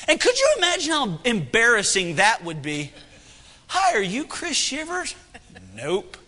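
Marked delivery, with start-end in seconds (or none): 3.7-5.2 high, tentative mock greeting; acting out an awkward approach; timid fan. Fan waking a sleeping cowboy, timid and high. 5.7-6.2 low, soft one-word brush-off; acting a sleepy denial; curt cowboy reply. Woken cowboy answering a fan, low and curt.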